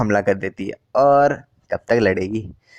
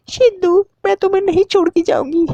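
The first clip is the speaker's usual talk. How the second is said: much higher mock female voice; girlfriend's voice. Girlfriend promising Siddhu, high mock falsetto. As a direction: play the girlfriend in a squeaky falsetto. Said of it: "तो लड़की बोलती है".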